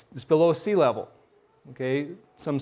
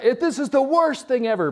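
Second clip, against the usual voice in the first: angry commander; 10 semitones higher, 3 dB louder than usual. Retold or imitated. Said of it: "He, he thought".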